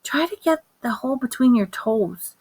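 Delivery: mother whispering to her son in the store; whispered advice. Acted. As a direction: whisper the tip quickly and secretly. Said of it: "my mother said".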